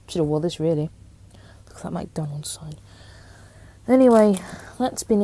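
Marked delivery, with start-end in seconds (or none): 1.7-2.8 hushed whispered aside. Real, not acted. Vlogger whispering an aside to viewers about a badge. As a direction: breathe it out as a secret.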